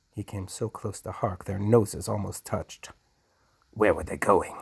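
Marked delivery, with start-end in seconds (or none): none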